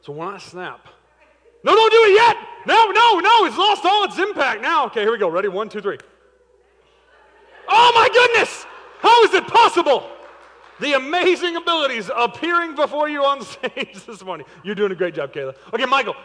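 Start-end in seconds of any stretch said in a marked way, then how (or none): 1.6-4.8 high, loud mock panic; panicked shout. Real, not acted. Volunteer moves too early. 7.7-14.5 high, loud amazed hype; showman announcer. Pretend. Showman hails the trick.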